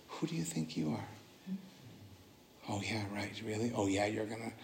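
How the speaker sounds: hushed mocking doubt; destructive whisper voice. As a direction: taunt him softly like an inner demon. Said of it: "You know this voice? The destructive whisper".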